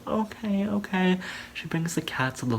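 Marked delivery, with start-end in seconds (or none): 0.1-1.2 much higher, put-on voice; put-on high okay. Rebecca giving in to her roommate, put-on high voice. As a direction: imitate someone agreeing in a high voice.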